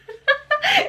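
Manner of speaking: squealing laugh at her own example; high burst of laughter. Real, not acted. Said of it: "Isso veio na minha cabeça agora". (risada alta e aguda)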